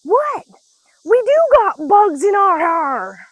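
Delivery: put-on very high, loud, slow child's protest; child answering her mom, high and loud; innocent little girl. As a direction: insist with total innocence like a five-year-old. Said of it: "she's like".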